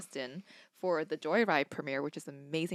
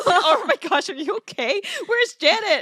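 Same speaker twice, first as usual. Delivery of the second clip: high, loud worried friend voice; mock alarmed; 9 dB louder than usual. Friend mimicking Mel's missing reaction, high alarmed shriek. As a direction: shriek concern over the phone.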